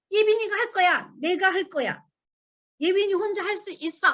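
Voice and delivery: put-on high, pleading little-child voice; imitating a child's high demanding whine; whiny toddler insisting. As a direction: play a stubborn toddler saying she can do it alone. Toddler insisting on doing it herself.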